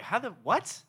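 high, loud, confused squeal; baffled disbelief. Retold or imitated. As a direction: sound utterly bewildered. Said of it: "You're like".

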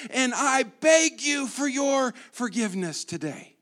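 high, loud, slow hammed-up begging; groveling apology. Pastor mocks the apology people want, hammed-up and loud. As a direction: overplay a grovelling sinner on their knees. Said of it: "and they say".

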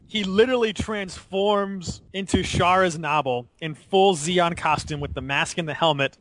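ordinary talk

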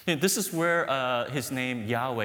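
ordinary talk, nothing marked

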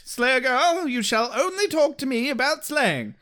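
high, stiff, pompous Englishman; pompous Wesley. Retold or imitated. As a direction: lay down the law, high and haughty. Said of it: "He's like".

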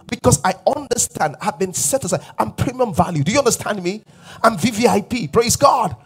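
loud, high-pitched sermon declaration; forceful preaching. Real, not acted. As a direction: preach it with mounting conviction and force.

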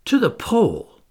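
high, loud cry of disbelief; disbelieving exclamation. A narrator's reading. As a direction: blurt it out, unable to believe it. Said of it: "I exclaimed, unable to keep back a movement of disbelief".

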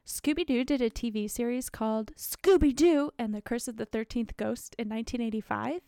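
ordinary talk, nothing marked

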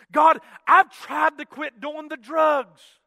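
loud, high, slow cry to God; desperate plea. Retold or imitated. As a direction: shout the prayer out, drawing words long. Acting out desperate prayer, escalating.

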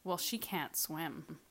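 slow, soft, dead serious mom. Retold or imitated. Mom warning about swim lessons, slow and dead serious. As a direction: mimic mom's grave certainty, slowly. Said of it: "completely serious".

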